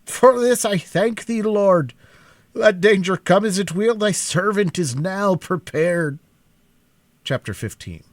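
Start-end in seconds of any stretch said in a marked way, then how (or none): 0.1-6.2 high, loud Scottish thanksgiving; old Scottish officer thanking God, Scottish accent loud. A narrator's reading. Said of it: "exclaimed in a peculiar accent of Scotland".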